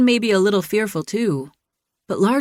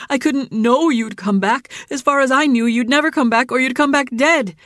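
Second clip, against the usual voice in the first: higher, louder, distraught; upset outburst; 6 semitones higher, 4 dB louder than usual. A narrator's reading. Jilly telling Dylan she thought he'd die, distraught. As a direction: let the fear spill out as anger.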